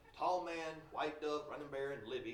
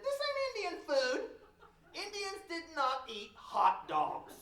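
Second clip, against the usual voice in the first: put-on much higher kid voice, slow and complaining; boy complaining the hot dogs aren't Indian food, high and whiny; child's high whine; 12 semitones higher, 6 dB louder, slower than usual. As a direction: whine it like an indignant little boy. [name] as a child objecting.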